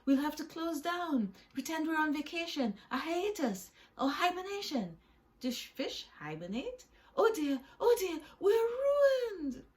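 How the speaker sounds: high, loud, frantic wail; hammed-up panic, rising to a high cry; panicking. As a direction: despair theatrically as disaster looms. Freddie panics about shark customer.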